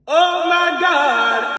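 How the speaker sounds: high, loud, drawn-out exclamation; shocked outcry. Retold or imitated. Patient reacting to the new price, shocked outcry.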